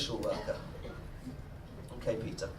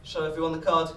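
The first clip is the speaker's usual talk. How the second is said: usual speaking voice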